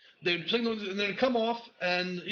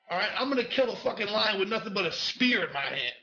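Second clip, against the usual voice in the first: ordinary talk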